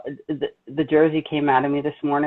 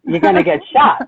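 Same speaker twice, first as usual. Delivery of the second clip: louder tough threat; Jersey attitude; 4 dB louder than usual. Retold or imitated. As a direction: warn them like a Jersey tough. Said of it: "my jersey coming out".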